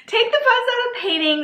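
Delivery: high playful voice. Acted. Rereads the line in a high voice.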